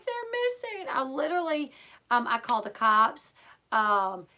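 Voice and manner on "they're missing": panicked cry. Retold or imitated. Mother tells her brother the kids are missing, panicked. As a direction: voice jumps high in retold panic.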